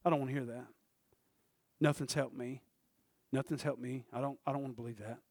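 low, soft reluctant voice; muted refusal. Retold or imitated. Preacher voicing someone who will not believe, muted refusal. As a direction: mildly mimic a quiet, low doubter.

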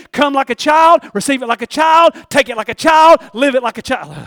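loud, high, rhythmic preacher's refrain; full-force preaching. Real, not acted. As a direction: drive each phrase home like a revival preacher.

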